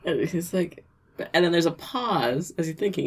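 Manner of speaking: ordinary talk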